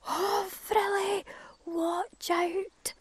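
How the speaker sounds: high, loud, slow alarmed shout; crow shouting warning. Acted. Crow shouting as the nest cracks under cuckoo. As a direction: warn her before she falls. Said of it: "suddenly Crow shouted".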